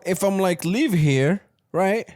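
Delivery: ordinary talk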